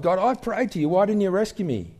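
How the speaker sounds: high, plaintive protest to God; mock indignant plea, pitched high; aggrieved complaint. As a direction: complain like you were let down. Drowned man complaining to God.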